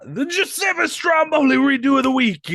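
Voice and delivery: high, shouted announcer build; segment announcer. Pretend. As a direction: trumpet the segment title over the top.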